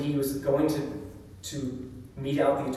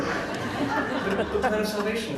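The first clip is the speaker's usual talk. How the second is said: laughing; 8 semitones higher, slower than usual. Real, not acted. Laughing at the empty phrase.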